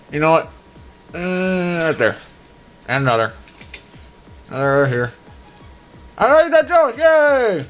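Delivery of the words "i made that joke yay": excited cheer. Real, not acted. Celebrates clearing a stage.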